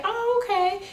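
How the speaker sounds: high, light pretend-casual "oh, okay"; mock high voice. Stylist playing it cool with a client, high mock voice.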